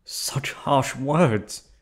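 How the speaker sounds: theatrical wounded indignation; indignant outrage. Acted. Offended outburst at captive.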